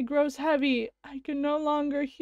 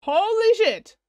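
shocked exclamation; 6 semitones higher, 6 dB louder than usual. Real, not acted. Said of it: "real shocked reaction".

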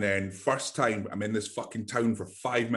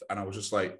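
ordinary delivery

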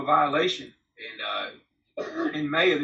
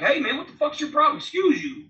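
sharp high angry snap at a stranger; mock confrontation with a stranger who bumped him; angry retort; 10 semitones higher than usual. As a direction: call him out, raised and irritated.

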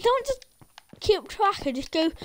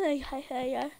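normal talking voice

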